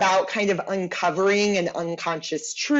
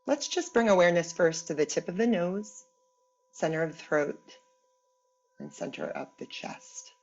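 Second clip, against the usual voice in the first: guided meditation; 3 semitones lower, 4 dB quieter than usual. Acted. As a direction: guide them gently inward, unhurried, letting each phrase settle. Leads guided imagery meditation.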